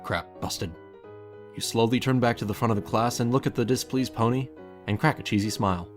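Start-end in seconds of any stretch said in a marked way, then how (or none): none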